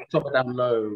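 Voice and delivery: loud, playful, laughing through it; jokey catchphrase through real laughter. Real, not acted. Joking sign-off amid shared laughter.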